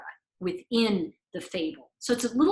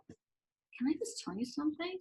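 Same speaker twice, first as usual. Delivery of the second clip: hushed, gentle question; quiet; 5 semitones higher, 11 dB quieter than usual. Retold or imitated. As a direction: lean in and say it softly. Gently confronting a client.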